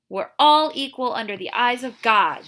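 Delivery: loud, firm declaration; declaring. A narrator's reading. Catherine declaring to the sheriff, loud. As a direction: proclaim it to his face. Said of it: "she declared".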